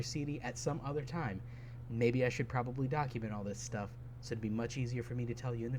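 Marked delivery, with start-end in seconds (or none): none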